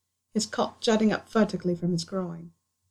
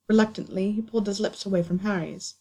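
ordinary delivery, nothing marked

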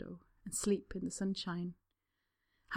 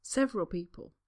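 ordinary delivery

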